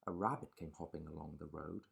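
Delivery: plain reading voice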